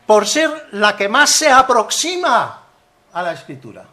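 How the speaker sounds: loud, high, slow declamation; hammed-up solemn quote, each word stretched; mock-pompous reading. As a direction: proclaim it like a grand decree. Hammed-up quote from the pronunciation manual.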